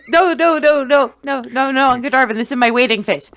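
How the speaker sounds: high, stammering protest; flustered protest. Acted. Flustered child character protests to uncle.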